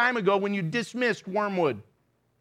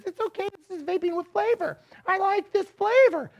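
mock vaper; 9 semitones higher than usual. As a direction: play the innocent vaper, pitched up. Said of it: "everybody says".

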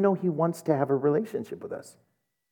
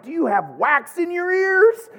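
high, pitched-up teasing question; mock incredulous; 13 semitones higher, 4 dB louder than usual. Teasing the confused girl at the window, high mock disbelief. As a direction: needle her with disbelief.

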